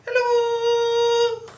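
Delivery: squeaky high-pitched hello, far up. Shown as a demonstration. Librarian leading kids, hello as high as can be. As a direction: reach as high as your voice goes. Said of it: "Let's say hello as high as we can".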